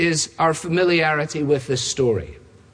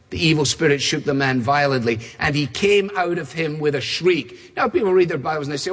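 ordinary talk

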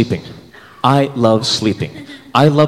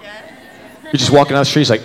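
ordinary delivery

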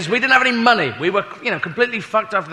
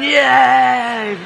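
crowd cheer; 6 semitones higher, 5 dB louder than usual. Retold or imitated.

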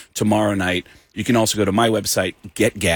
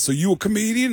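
higher voice for the other guy; retold star comedian; 7 semitones higher than usual. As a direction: lightly put on the comedian asking. Retold Tracy Morgan talking to teller.

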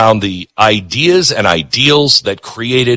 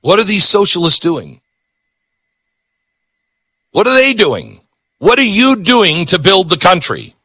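yelling; 9 semitones higher than usual. Real, not acted. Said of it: "I gotta yell at somebody".

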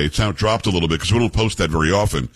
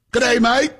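high, hammed-up Australian "good day, mate"; a jokey Australian greeting; mock Australian accent; 13 semitones higher than usual. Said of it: "That's for our Australian friends".

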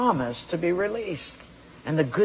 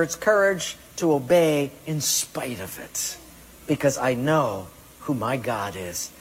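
normal talking voice

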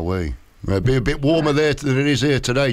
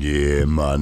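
lower, slow mock Jamaican greeting; Jamaican accent; 8 semitones lower than usual. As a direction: stretch "Carlton" slowly in a lightly put-on Jamaican accent. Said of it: "speaking like this".

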